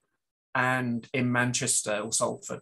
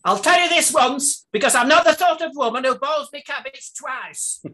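much higher, louder northern matriarch voice; Hilda Ogden; 12 semitones higher, 7 dB louder than usual. Retold or imitated. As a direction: deliver it like a blunt Coronation Street battleaxe. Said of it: "Hilda Ogden is saying".

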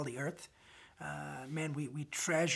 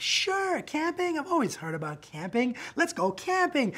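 high, loud eager yes; excited; 11 semitones higher, 7 dB louder than usual. Retold or imitated. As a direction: squeal with over-the-top enthusiasm for camping. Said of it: "I was so excited".

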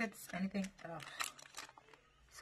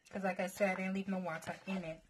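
ordinary delivery

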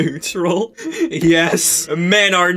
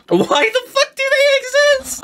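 high squeaky voice. Acted. Absurd parody character outburst.

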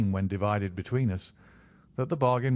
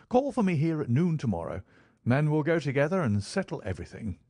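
higher-pitched, animated flatmate; keen Holmes; 7 semitones higher than usual. Acted. Holmes fixes a noon meeting with Watson, keen. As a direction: make the plan eagerly, voice lifted.

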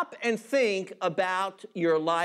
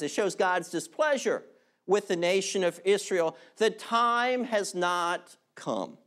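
ordinary talk, nothing marked

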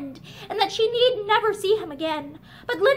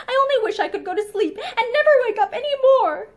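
sobbing; 6 semitones higher, 4 dB louder than usual. A narrator's reading. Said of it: "Linda's last sobbing words".

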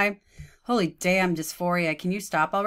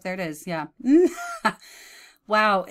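usual speaking voice

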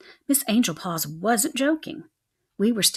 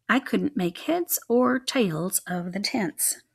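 usual speaking voice

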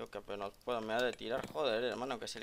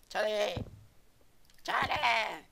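frustrated outburst; 10 semitones higher, 7 dB louder, much slower than usual. Real, not acted.

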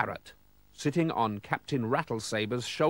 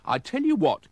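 higher, lively exclamation; exclaiming; 8 semitones higher, 4 dB louder than usual. A narrator's reading. Oldcastle offers the pirate a deal, raised exclamation. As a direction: pitch it up as a sudden idea. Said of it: "exclaimed Mister Oldcastle".

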